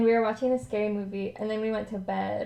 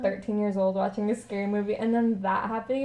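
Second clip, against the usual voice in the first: normal talking voice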